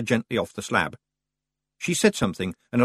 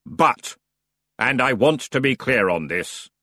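slow, weary boatman; reluctant giving in; grudging ferryman; slower than usual. Acted. The ferryman gives in to the Feegles, grudging.

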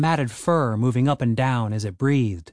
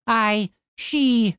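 flustered stammer; 9 semitones higher than usual. Acted. Tim stammering, caught out.

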